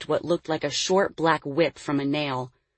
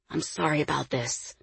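softer, hushed aside; whispered apology; 6 dB quieter than usual. A narrator's reading. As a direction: say sorry under your breath. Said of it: "Dante whispered".

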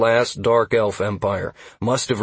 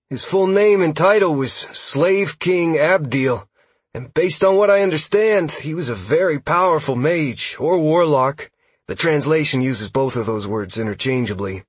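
higher-pitched, keen lore-geek voice; lively, higher young-scout character voice; eager elf scout; 7 semitones higher, 4 dB louder than usual. Acted. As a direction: explain it like an eager lore nerd. Narrator voices elf scout Huxley.

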